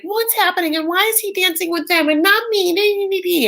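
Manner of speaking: high, whiny jealous mock; pouty whine. Darcy sulking over Tom dancing with others, high pouty whine. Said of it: "get pouty".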